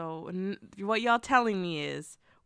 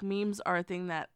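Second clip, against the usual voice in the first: ordinary delivery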